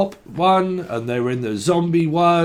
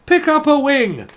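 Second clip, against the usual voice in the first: mock Chinese accent; 9 semitones higher, 5 dB louder than usual. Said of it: "what the fuck is that accent?".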